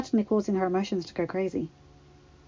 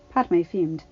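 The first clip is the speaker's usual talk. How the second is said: ordinary delivery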